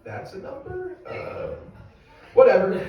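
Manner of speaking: halting counter losing track, then shrugging it off; confused counting. Acted. Comic confessing trouble counting past thirteen, confused. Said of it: "start to get confused".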